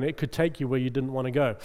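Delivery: ordinary delivery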